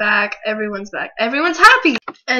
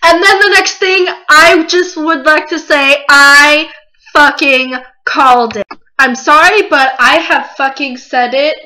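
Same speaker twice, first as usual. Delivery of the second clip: much louder, crowing with excitement; triumphant rant; 2 semitones higher, 7 dB louder than usual. Real, not acted. Fan boasting to viewers that the prediction came true. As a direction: shout that you called it. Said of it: "my little rant".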